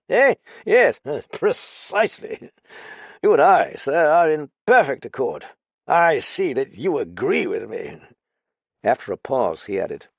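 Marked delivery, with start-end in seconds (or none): 0.1-8.0 a hint of a tipsy, pompous officer, pitched up; lightly voiced boozy ex-officer; slightly raised, loud drunken bluster; drunk lieutenant. Drunk Graffin holding forth.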